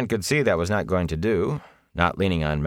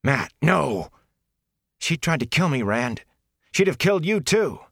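high, alarmed outburst; heated, high-pitched alarm; urgent protest; 9 semitones higher than usual. Acted. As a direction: plead and accuse in a raised pitch. Urgent protest over an attack.

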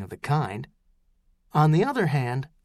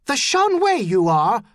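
loud, high defiant retort; fierce yell at boarders; shouted back; 8 semitones higher, 6 dB louder than usual. A narrator's reading. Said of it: "his mother shouted back".

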